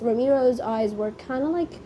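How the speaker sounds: ordinary talk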